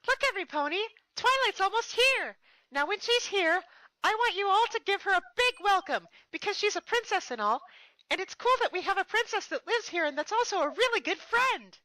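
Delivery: very high, loud bubbly voice; squeaky excited party pony shouting; Pinkie Pie. Acted. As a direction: whip up the crowd with glee. Pinkie rallying the crowd.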